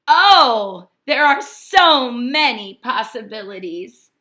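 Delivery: delighted. Acted. Delight at the new shell.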